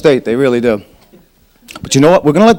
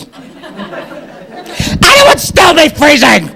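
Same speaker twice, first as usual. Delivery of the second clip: laughing exclaim; 9 semitones higher, much slower than usual. Real, not acted. Punchline yelled laughing.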